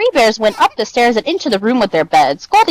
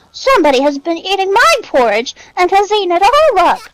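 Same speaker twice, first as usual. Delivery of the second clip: high, tiny bear cub voice; small wee Baby Bear; 10 semitones higher, 3 dB louder than usual. A narrator's reading. Baby Bear finds his porridge all gone, small high voice. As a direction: squeak it up high like a little bear. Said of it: "in his small, wee voice".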